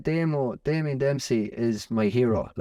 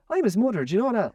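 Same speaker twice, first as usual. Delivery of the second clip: much higher, lilting mimicked mother; proud mother; 10 semitones higher, faster than usual. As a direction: imitate a mother announcing herself, pitch swooping. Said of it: "And she goes".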